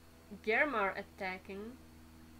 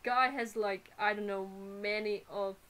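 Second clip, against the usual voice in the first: normal talking voice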